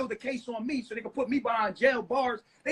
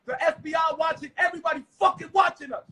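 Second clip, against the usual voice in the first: angry rant; 4 semitones higher, 3 dB louder than usual. Real, not acted. Ranting at wife, yelling.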